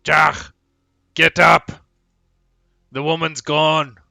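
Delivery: loud, high urgent bellow; orc yelling alarm. Acted. Orc waking comrade as captive has escaped, bellowing. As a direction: rouse a sleeping comrade at full volume. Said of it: "And he's like".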